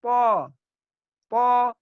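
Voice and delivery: po said loud with puffed cheeks; Arabic letter po. Shown as a demonstration. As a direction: puff the cheeks and voice po heavily. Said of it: "Kemudian po". (po diucapkan dengan pipi menggembung)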